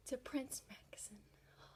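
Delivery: breathless whisper of a smitten fan; dreamy whispered swoon over Prince Maxon. Real, not acted. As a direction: whisper his name like a crush.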